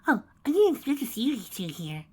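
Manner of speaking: high, fake innocent voice; sing-song fake casual; falsely sweet. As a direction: overplay the surprise so it rings false. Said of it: "in a voice so false it made Sunset cringe".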